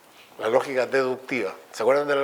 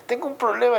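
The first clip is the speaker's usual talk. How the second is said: much higher cartoonish voice; high mock voice; 11 semitones higher than usual. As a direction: squeak out the complaint. Acted dialogue of philosophers.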